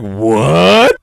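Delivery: loud disbelieving What; shocked. Retold or imitated. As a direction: blurt it out in shock. Shocked reaction quote.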